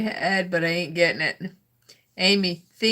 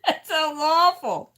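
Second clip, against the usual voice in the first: high, squealed through laughter; laughing; 8 semitones higher than usual. Real, not acted. Reacting to a crude joke, laughing. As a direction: say it helplessly amused, pitch shooting up.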